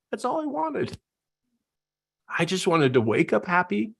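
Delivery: ordinary talk, nothing marked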